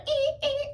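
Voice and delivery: high screechy stabbing-music squeal; mock horror-movie shriek. Mimicking slasher-film stab squeals at Emma's knife, very high. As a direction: squeal like the shower-scene violins.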